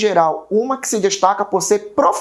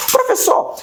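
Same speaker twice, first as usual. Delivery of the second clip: high-pitched student calling the teacher; high mock-student voice; student asking; slower than usual. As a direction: pipe up like an eager pupil.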